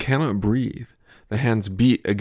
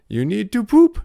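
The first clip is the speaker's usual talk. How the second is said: put-on toddler voice; 12 semitones higher, 8 dB louder than usual. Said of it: "my toddler's play".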